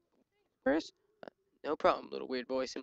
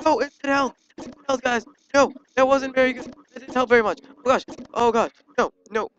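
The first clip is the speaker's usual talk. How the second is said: loud, high-pitched gamer panic; frantic raised shouting; panicked yelling; 8 semitones higher, 9 dB louder than usual. Real, not acted. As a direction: yell in alarm as everything goes wrong. Caught on fire in the game.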